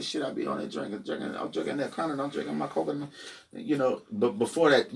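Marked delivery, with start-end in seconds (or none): 0.0-3.1 imitated Atlanta mumble. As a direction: slur it like an Atlanta mumbler, word ends dropped. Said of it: "motherfuckers that talk like this. Goddamn, they don't finish their words".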